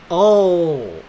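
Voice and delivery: long, loud, high "oh!"; drawn-out burst of realization; surprised realization. Real, not acted. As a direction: cry out as it suddenly dawns on you. Sudden realization about the story.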